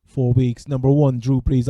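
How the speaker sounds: usual speaking voice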